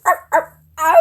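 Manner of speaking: loud barks into a howl; dog bark and howl. Shown as a demonstration. Showing how a dog goes, loud barking and howling. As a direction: bark twice, then let out a long howl. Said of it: "a dog goes".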